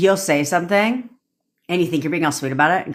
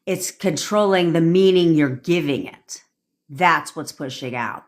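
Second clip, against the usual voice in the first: ordinary delivery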